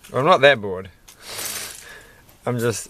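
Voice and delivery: ordinary talk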